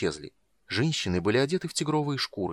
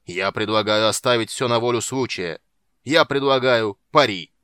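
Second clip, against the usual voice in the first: bold proposal; 8 dB louder than usual. A narrator's reading. The dwarf loudly proposes a wager to the gem. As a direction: boom out the wager.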